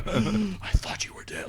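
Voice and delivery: gasp, then a slow whisper of disbelief. Retold or imitated. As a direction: breathe it out in shock. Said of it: "Rey's just like".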